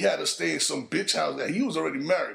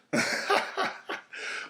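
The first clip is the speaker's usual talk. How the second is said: breathy laugh; 14 semitones higher than usual. Real, not acted. Comic laughs at own punchline.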